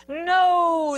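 long, loud storybook no; loud chorus-like refusal; crowd yell. A narrator's reading. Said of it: "the crowd yelled".

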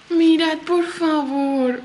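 ordinary delivery

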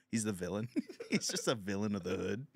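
ordinary delivery

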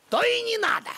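put-on an offended old man's huff; huffy. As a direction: pitch jumps high in offended huff. Huffy refusal.

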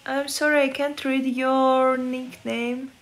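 ordinary talk

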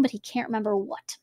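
usual speaking voice

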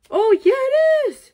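high, loud squeal of joy; excited squeal. Real, not acted. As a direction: squeal it like a thrilled fan. Excited fan finds card.

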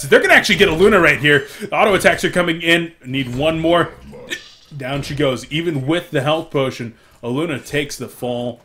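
loud, fast esports kill call; excited play-by-play. Acted. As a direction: call the kill with rising hype.

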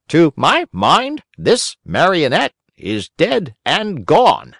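high, slow, self-important bird; pompous crow doctor. A narrator's reading. Crow doctor solemnly declares the puppet's fate, high and slow. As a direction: pronounce the verdict like a grand old crow.

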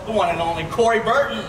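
ordinary talk

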